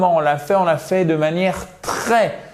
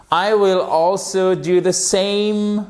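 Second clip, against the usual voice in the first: slow, higher, careful English sentence; slow model sentence; 5 semitones higher, slower than usual. Shown as a demonstration. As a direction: read it slowly for learners to copy. (phrase modèle lente et appliquée)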